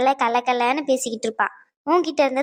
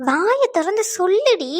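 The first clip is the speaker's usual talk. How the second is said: higher, drawn-out plea to talk; insistent urging; 7 semitones higher, slower than usual. Acted. Friend urging a crying friend to speak up, insistent. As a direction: press her to open up, slowly and higher.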